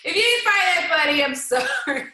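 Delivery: helpless laughter breaking into the words; laughing. Real, not acted. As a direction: talk through real giggles. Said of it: "even though I'm laughing".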